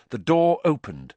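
ordinary delivery

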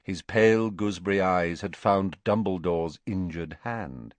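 ordinary delivery

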